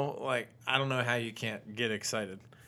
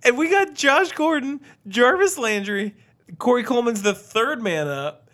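laughing while talking; 9 semitones higher, 8 dB louder than usual. Real, not acted. Still laughing after drunk history joke.

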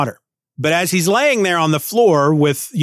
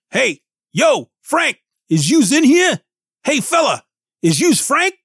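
high, gruff Brooklyn tough-guy robot; a tiny robot doing a New York bruiser; Ben Grimm; 10 semitones higher than usual. Acted. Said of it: "the accent that Allred gives him makes me think of Ben Grimm".

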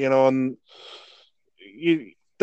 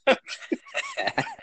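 real high-pitched burst of laughter; 7 dB quieter than usual. Real, not acted. Recalling funny recording.

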